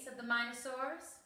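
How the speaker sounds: ordinary delivery, nothing marked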